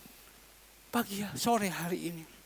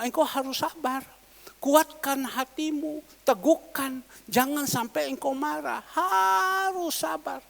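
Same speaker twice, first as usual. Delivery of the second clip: higher, louder, slow and solemn command; voice of God; 6 semitones higher, 3 dB louder than usual. Acted. God tells Moses to be patient, solemn and slow. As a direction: speak as God urging patience, drawn out. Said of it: "Tuhan sudah ngomong".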